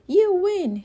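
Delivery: put-on loud, high girl's shout. A narrator's reading. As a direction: call out in defeat. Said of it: "shouted Jaya".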